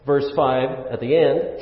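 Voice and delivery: ordinary talk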